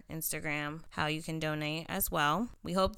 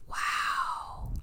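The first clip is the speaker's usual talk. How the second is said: breathless whisper of awe; whispered wow. Real, not acted. Reacting to unsolved murder.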